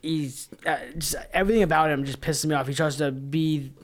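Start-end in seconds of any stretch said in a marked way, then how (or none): none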